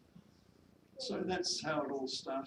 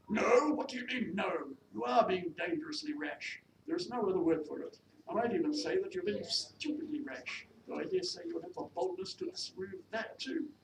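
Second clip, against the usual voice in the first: high, indignant scolding from a lady employer; indignant mistress; 7 semitones higher, 5 dB louder than usual. Acted. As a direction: rebuke the maid with haughty authority. Mistress rebukes maid.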